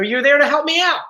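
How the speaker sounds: usual speaking voice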